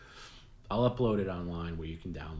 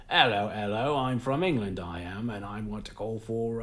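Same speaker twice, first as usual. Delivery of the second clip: bad English accent; 2 semitones higher, 5 dB louder than usual. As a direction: do a terrible mock English accent for a prank caller. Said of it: "they'll just do a really bad, like, accent".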